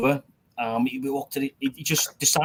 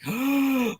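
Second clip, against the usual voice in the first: sharp high gasp of horror; a quick gasp of disbelief; shocked gasp; 12 semitones higher than usual. Retold or imitated. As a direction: gasp as the puppy is shot. Dog shot in front of him.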